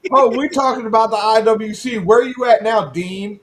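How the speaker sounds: high, loud taunting call-out. Real, not acted. Host goading an internet critic in the comments, mocking taunt. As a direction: goad the absent critic to speak up.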